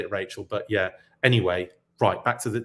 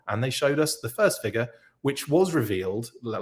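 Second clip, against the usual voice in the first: usual speaking voice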